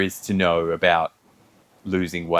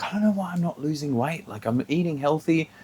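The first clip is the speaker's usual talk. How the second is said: higher, softer mock whinge; imitated whinging; 5 semitones higher, 6 dB quieter than usual. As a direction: lightly imitate a complaining coworker. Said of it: "constantly whinging".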